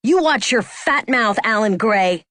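ordinary delivery

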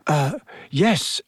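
hesitant, mildly nervous answer. A narrator's reading. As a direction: answer haltingly, with a touch of nerves. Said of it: "said Neville nervously".

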